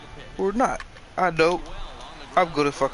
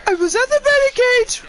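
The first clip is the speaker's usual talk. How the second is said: much higher, strained squeak after a nutshot; high squeaky injured voice; 13 semitones higher than usual. Pretend. To the doctor, telling the batting cage story in a squeak. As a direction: squeak it out like your nuts just got hit. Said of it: "That's why I'm talking like this".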